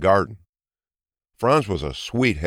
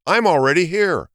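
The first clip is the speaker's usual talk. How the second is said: higher, louder call from afar; shouting; 8 semitones higher, 3 dB louder than usual. A narrator's reading. Hedgehog shouts from the start line. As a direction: call it out across the track. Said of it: "she heard someone shouting".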